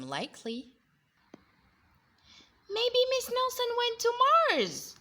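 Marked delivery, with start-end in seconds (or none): 2.7-5.0 put-on high, loud child's voice; excited kid. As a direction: offer a far-fetched idea eagerly. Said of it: "said another kid".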